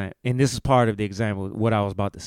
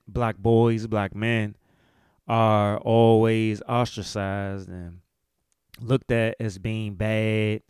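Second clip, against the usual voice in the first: ordinary delivery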